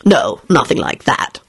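slow, offhand denial; very casual. A narrator's reading. Lupin denying a girlfriend, very casual. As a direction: brush it off casually, unhurried. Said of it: "Lupin replied very casually".

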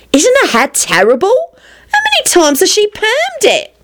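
high-pitched catty aside; sighing gossip. A narrator's reading. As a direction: sigh it high, as gossip about someone nearby. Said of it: "Marlena sighed to Elizabeth and Rosie".

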